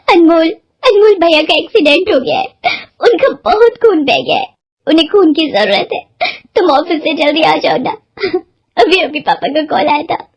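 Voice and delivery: tearful, high, pleading call; crying. A narrator's reading. Sister on the phone, crying about her brother's accident. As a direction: voice breaking as she begs him to come. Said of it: "उसने रोते रोते कहा".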